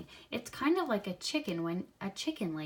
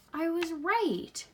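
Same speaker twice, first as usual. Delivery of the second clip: delighted; 9 semitones higher, 4 dB louder, slower than usual. Real, not acted. Reader delighted at prediction.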